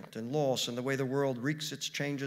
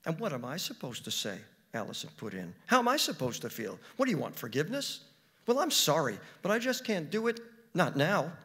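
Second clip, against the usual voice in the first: upset Allison; 4 semitones higher, 5 dB louder than usual. Acted. Allison angry at the girl.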